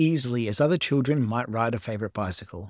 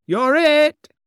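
very high, loud shout; squealed tag; gleeful taunt; 11 dB louder than usual. A narrator's reading. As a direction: yell it as you fly off. Boy tags father and flies.

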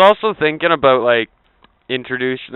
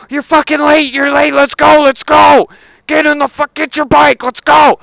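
much higher and louder mock rousing shout; yelling dad; 13 semitones higher, 8 dB louder than usual. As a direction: bark the kid awake like a drill sergeant. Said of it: "Be like".

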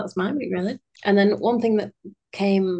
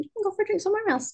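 mum's voice; 13 semitones higher, much faster than usual. Retold or imitated. Mum's words quoted after drinks.

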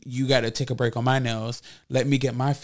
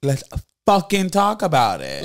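higher, louder pumped-up echo; hyped up; 5 semitones higher, 6 dB louder than usual. Acted. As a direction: shout it like a hype man. Hyped echo of topic intro.

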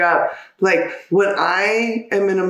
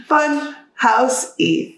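slow mock fun housey; 4 semitones higher, much slower than usual. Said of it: "a little more fun housey".

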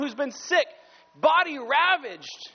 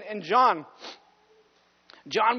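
ordinary talk

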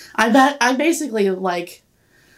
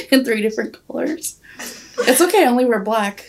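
normal talking voice

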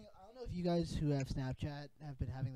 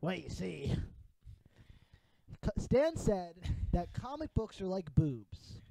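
slow, laid-back surfer drawl; lightly voiced surfer dude, slowed down; 3 dB louder, slower than usual. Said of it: "Can you do it more like a surfer voice?".

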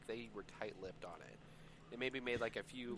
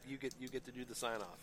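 normal talking voice

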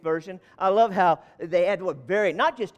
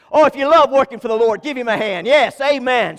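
much louder cheer for the Lord; rallying call; 4 semitones higher, 9 dB louder than usual. Real, not acted. Pastor calling the church to give God a hand, loud. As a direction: rouse the crowd to clap.

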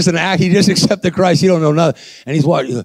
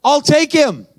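grab it with joy; new convert accepting Jesus, overjoyed; joyful eager; 12 semitones higher, slower than usual. Retold or imitated.

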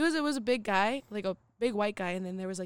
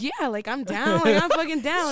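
loud, rising eager agreement; excited like a kid; 5 semitones higher, 8 dB louder than usual. Retold or imitated. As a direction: replay it as a kid bursting with eagerness. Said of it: "like a, like a kid".

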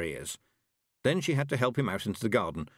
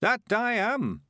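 put-on high, slow creaky old man voice; an eager old countryman, chuffed; old Mister Weevil; 13 semitones higher, 4 dB louder, much slower than usual.

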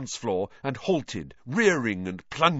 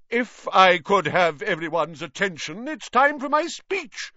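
loud, much higher call for attention; loud announcement; 9 semitones higher, 5 dB louder than usual. Acted. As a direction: raise your voice over the crowd. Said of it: "said nearly headless Nick loudly".

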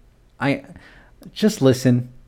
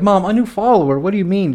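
higher-pitched retold disbelief; incredulous son; 7 semitones higher than usual. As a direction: question your mother in baffled protest. Retold bewildered son questioning mother.

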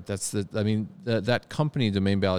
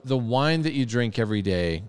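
ordinary talk, nothing marked

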